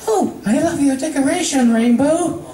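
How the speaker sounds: compliment shyly with a smile; Fluttershy, meek praise. Acted. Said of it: "giving a meek smile".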